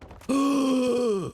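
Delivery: sharp, drawn-out gasp of surprise; startled gasp. Real, not acted. Player nearly falling off a ledge, sharp gasp. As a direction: gasp as the jump nearly fails. Reacts to a jump.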